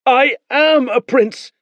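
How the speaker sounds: high, slow insistence; defiant boy. Acted. Boy repeats his claim to Beren, insistent. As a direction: stamp each word. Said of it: "the boy said".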